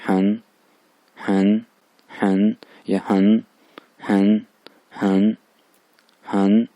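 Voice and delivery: हन said slowly and carefully, syllable by syllable; drilled Chinese syllable. Shown as a demonstration. Teacher drilling the syllable हन for the class. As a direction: repeat हन like a drill. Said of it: "वही इनको N पढ़ते".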